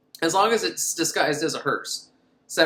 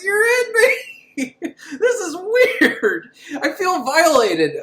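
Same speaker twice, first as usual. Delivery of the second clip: high, slow and louder, breaking into laughter; mock tall man wail; 13 semitones higher, 6 dB louder, much slower than usual. Tall man to his victim, a laughing mock wail. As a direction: whine it out while cracking up.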